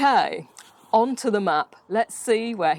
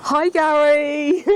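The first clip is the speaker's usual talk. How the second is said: slow, loud drawn-out hail; calling out; 5 semitones higher, 7 dB louder, slower than usual. Real, not acted. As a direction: call across to Gary as he answers. Host calls out to Gary.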